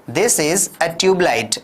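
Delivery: slow, clear classroom drill; slow model sentence. Shown as a demonstration. Teacher drilling simple sentences slowly for learners. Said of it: "तो आप क्या कहेंगे?".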